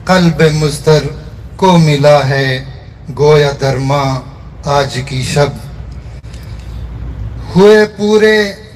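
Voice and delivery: measured mushaira recitation, line by line; poetry recitation. Acted. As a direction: recite the verse in separate phrases, lingering on the refrain. Recites own poem at a gathering. (मुशायरे के अंदाज़ में शायरी)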